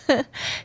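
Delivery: small breathy laugh; wry chuckle. Acted. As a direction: chuckle at your own jibe. Mocking the boss.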